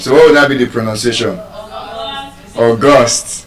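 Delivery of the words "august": auGUST, with GUST hit firmly; August stressed on its last syllable; stress on second syllable. Shown as a demonstration. Teacher pronouncing the adjective for the class, second-syllable stress.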